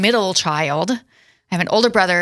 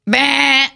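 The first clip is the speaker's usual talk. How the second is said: short loud buzzer sound; mock buzzer noise; 6 semitones higher, 4 dB louder than usual. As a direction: blurt it like a quiz buzzer. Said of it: "I didn't have my buzzer ready".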